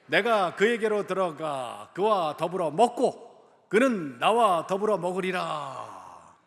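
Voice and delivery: loud, slow, measured reading in phrases; scripture recitation. Real, not acted. Preacher leading the church in reading Revelation, loud declamation. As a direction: proclaim it for a congregation to read along.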